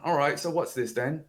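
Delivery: put-on British accent. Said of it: "That was pretty good. Accent".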